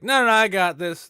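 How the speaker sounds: high, loud burst of cocky assurance; confident brain stem. Acted. Brain stem overruling the mind, confident and loud. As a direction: blurt it high and loud, brushing off doubt. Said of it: "brain stem is just like".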